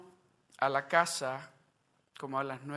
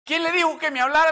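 much louder, higher, fast cry; climactic shouted question; 11 semitones higher, 15 dB louder, faster than usual. Acted. Climax of sermon story. (pregunta gritada en el clímax)

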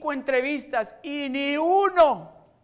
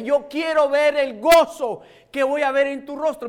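high, loud preacher's exhortation, slowed at the peaks; preaching; 6 semitones higher, 10 dB louder than usual. Acted. Pastor urging congregation to ask, preaching loudly. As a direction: urge them on with full fervor.

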